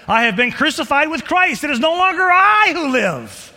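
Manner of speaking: loud, high proclamation; preaching shout. Acted. Preacher shouting Paul's words to the congregation. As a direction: cry it out with conviction. Preaching builds to full force.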